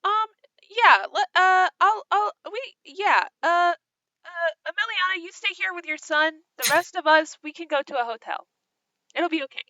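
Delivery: high-pitched, halting character voice; hesitant, reassuring character. Acted. Player in character sends companions to hotel, high and hesitant. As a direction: pitch it high and fumble toward a gentle decision.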